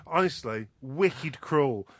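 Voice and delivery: ordinary talk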